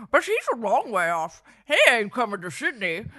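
gruff put-on old man; old toll keeper voice. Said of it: "come up with a voice for this guy".

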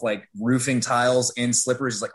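normal talking voice

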